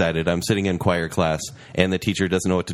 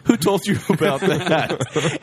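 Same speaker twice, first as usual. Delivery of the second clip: slow, higher, laughing protest; mock dismay; 7 semitones higher, much slower than usual. Storyteller teased about puking nickname, mock dismay. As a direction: draw it out in pretend horror.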